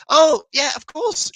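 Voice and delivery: high, loud "oh" of it finally clicking; light up as the penny drops; sudden realisation. Real, not acted. Host realising what the viewer meant, suddenly delighted.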